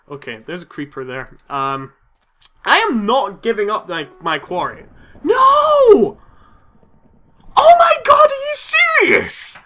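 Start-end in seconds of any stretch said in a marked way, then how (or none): 5.2-9.6 high, loud despairing cry; anguished scream. Real, not acted. Slow wailing shout of disbelief. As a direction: gamer losing his hardcore run, screaming. Dies in hardcore game.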